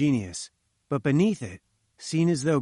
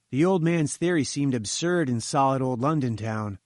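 plain reading voice

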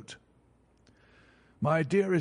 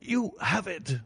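a breathless, higher gasp of relief; 6 semitones higher, slower than usual. Acted. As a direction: gasp it out in disbelief. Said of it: "he gasped".

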